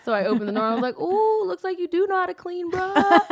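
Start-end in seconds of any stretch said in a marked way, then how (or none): 1.0-3.2 high, loud singsong taunt; mock-amazed, needling "ooh"; gloating tease. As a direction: crow it teasingly, pitched way up. Teasing Caleb caught cleaning.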